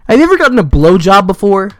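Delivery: retold loud, pushy question; loud mock question. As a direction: blurt the question out loudly in imitation. Classmate's blurted question acted out.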